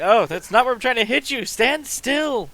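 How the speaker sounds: high, loud exasperated yell; frustrated shout. Acted. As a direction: yell at someone who won't hold still. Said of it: "he's just like".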